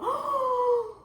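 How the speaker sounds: sudden high gasp of realization; a real startled intake of breath; sharp gasp. Real, not acted. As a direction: catch the breath in shock.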